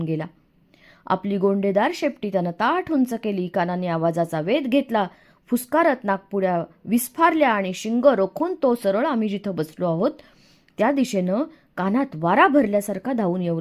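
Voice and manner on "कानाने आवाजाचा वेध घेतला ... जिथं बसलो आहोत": plain reading voice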